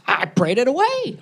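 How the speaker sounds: put-on camp voice; hammed-up camp reply. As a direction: keep up the flamboyant imitation. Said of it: "I have to be that guy".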